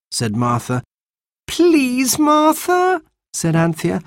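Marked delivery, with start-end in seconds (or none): 1.5-3.0 put-on high, wheedling child's plea; pleading. A narrator's reading. Anthea begs Martha to take Baby, pleading. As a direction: beg her, pitched right up.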